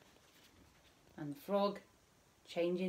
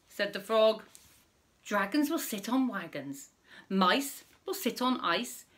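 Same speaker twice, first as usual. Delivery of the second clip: put-on reading to children; 3 semitones higher, 5 dB louder than usual. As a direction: read it out big for little listeners. Reading rhyming story to children.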